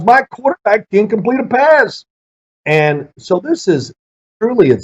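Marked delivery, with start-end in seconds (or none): 0.0-2.0 coach's lament. Retold or imitated. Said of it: "He goes".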